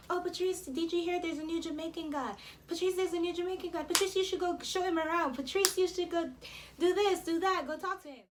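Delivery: high, rapid-fire imitation of eager classmates; pestering schoolmates. Everybody pestering her, voiced in quick succession.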